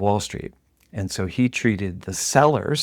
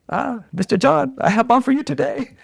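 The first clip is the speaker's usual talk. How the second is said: hammed-up Chinese-accented English, much higher; mock Chinese accent; 3 dB louder than usual. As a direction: sing out a greeting in an exaggerated Chinese accent. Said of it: "I apologize for my accent".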